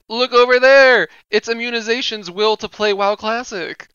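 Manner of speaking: loud, high, excited call; excited shout. Pretend. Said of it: "we'll be like".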